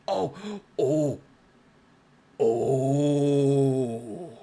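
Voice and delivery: gasping oh of delight; startled happy oh; excited surprise. Real, not acted. Reading a new reward.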